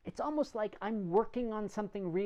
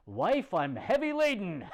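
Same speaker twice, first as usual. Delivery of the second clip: loud, hammed-up groan to his wife; mock weary husband; 3 semitones higher, 7 dB louder than usual. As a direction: play the exhausted husband for a laugh. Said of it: "I don't come home from work and go".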